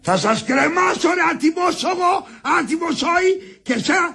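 high, outraged threat; angry shouting. Acted. Husband threatening to hang his wife and her brother, shouting. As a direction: bellow the threat in indignation. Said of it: "Μη φωνάζεις όλον".

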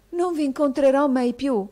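put-on boy fears losing his only friend, eager rush; unusually fluent. A narrator's reading. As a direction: pour it out eagerly. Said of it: "con insolita scioltezza e volubilità".

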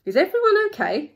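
high, loud storybook question; animated character question; Timothy asking. Acted. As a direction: check on everyone, acted and high. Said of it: "Timothy asked".

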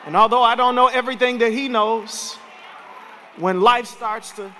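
rising to a shouted climax; preacher lifting the church about Jesus, building to a shout; preaching at full force. Acted. Bring it home, loud and high.

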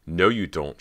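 loud, sharp snap; sharply refusing; sharp retort. A narrator's reading. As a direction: cut him off sharply. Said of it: "she replied sharply".

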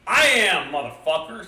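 loud, high comeback to the troll; defiant shout. A narrator's reading. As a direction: shout back boldly, easing off at the end. Goat defiantly answers the roaring troll.

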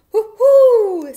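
very high, loud hoot; indignant owl screech-hoot, full volume; angry owl hoot. A narrator's reading. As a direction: hoot sharply like a cross owl. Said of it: "said the owl angrily".

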